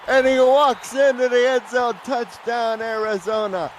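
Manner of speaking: rising excited shout of "Touchdown, Arizona"; touchdown call. Real, not acted. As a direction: belt out the score, building to "Touchdown".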